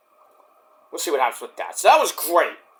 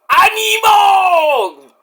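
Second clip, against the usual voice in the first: drawn-out high shout, slow; entrance cry; 11 semitones higher, 5 dB louder, much slower than usual. Retold or imitated. Fenix's entrance cry heard from the crowd, shouted. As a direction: yell it like an arena entrance.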